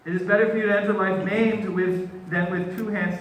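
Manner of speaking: loud, high, impassioned scripture reading; vehement warning. Acted. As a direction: press the warning home at full voice. Vehement reading of Jesus's warning continues.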